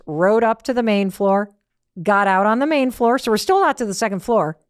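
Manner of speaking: normal talking voice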